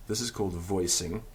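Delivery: ordinary delivery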